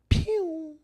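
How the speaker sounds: high sharp pew; a cartoon gunshot noise; mock gunshot. Shown as a demonstration. Comic mimicking the shot into the rib. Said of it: "shoot somebody like this".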